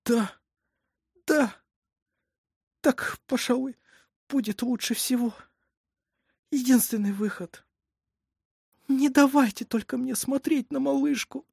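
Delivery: high, halting, weary assent; swallowing tears. A narrator's reading. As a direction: give up the baby, tearful and exhausted. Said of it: "Мать, глотая слёзы, устало".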